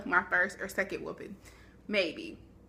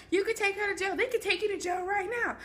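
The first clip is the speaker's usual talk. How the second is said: mom threatening; 11 semitones higher than usual. Retold or imitated. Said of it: "She's like".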